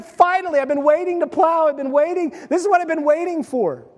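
excited farmer. Retold or imitated. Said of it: "he's like".

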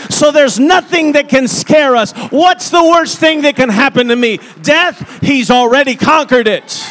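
loud, high, building preacher's cry; fiery pulpit preaching, rising loud; full-force preaching. Acted. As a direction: preach it hard and lift it to a shout.

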